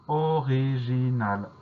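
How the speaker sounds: "original" drawn out syllable by syllable; slow dictation. Shown as a demonstration. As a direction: dictate "original" slowly, stretching each syllable. Teacher dictates the new word.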